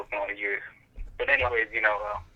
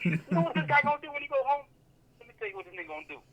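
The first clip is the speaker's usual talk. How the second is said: higher-pitched imitation of a roasting comedian, rushing the middle; mocking comedian; 12 semitones higher, faster than usual. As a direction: ham up the comic working the crowd. Retelling comedian's bit mocking him.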